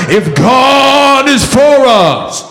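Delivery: high, very slow preacher's call. Shown as a demonstration. Preacher cueing congregation to answer, high preaching call. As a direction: stretch it high and long like a preacher. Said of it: "let me get in the preacher voice".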